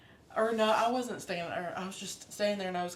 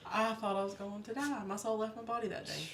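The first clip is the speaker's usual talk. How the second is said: ordinary delivery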